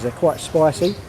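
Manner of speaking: usual speaking voice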